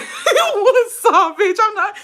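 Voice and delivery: very high, loud squealing voice; mock squeal. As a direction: imitate her in a piercing high pitch. Acting out a girl on the bus.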